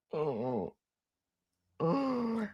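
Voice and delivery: ordinary delivery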